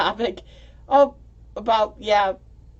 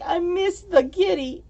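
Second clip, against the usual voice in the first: much higher, slow and pouty; mock whiny friend; 10 semitones higher than usual. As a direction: tease her by whining it. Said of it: "you're like".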